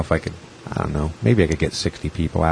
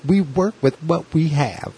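much higher, slow, put-on character voice; Saturday Night Live character; 8 semitones higher than usual. The lady echoing his line back, higher, slower and hammed up. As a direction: ham it up like a sketch-comedy lady. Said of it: "like a total, like, Saturday Night Live character".

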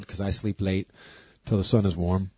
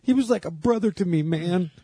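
much higher mock-earnest line; mock-earnest punchline, man; hammed-up sincere buddy; 8 semitones higher than usual. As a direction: overplay the heartfelt tribute. Joke quote, punchline take.

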